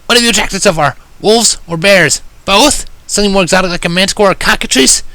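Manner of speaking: high, quick, brash tomboy voice; rapid Rainbow Dash. A narrator's reading. Rainbow Dash rattles off questions to the narrator, high and rapid. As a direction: fire off the questions, wary. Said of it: "she asked rapidly".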